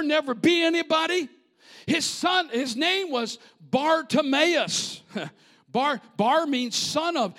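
high, loud, emphatic preacher voice; fired-up preaching. Real, not acted. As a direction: preach it hard, hammering each rebuke. Preaches at full force.

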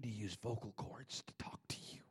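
soft breathy whisper. Shown as a demonstration. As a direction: drop to a whisper. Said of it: "he whispered".

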